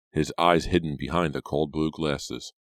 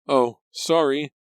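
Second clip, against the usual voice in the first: 1 startled apology; 11 semitones higher, 5 dB louder, slower than usual. A narrator's reading. Startled apology, stepping back.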